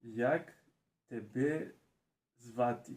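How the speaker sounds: halting English-accented question, word by word; English accent Ukrainian. Real, not acted. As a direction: beginner reading each word. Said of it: "So, read, please".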